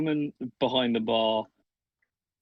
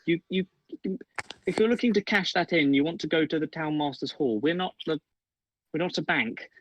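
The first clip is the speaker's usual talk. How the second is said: higher, flustered tavern keeper; flustered barmaid; 6 semitones higher than usual. Acted. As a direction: hurry them off to the hall. Barmaid shoos goblin head.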